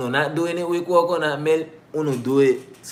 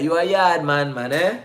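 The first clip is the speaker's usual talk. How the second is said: raised, lively Jamaican greeting; Jamaican accent; 5 semitones higher, 6 dB louder than usual. Retold or imitated. Jamaicans meeting her for the first time, imitated Jamaican accent. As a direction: imitate a Jamaican sizing up a stranger. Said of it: "when Jamaicans meet me".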